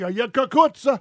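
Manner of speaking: lightly mock Italian, stretching "cucuzza"; weak Italian-American accent; bad Italian accent. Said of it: "You're terrible at that".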